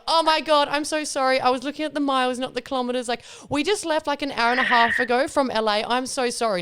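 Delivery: high-pitched mock pleading; flustered apology. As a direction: plead your way out of a ticket, retold. Retold pleading apology to the sheriff.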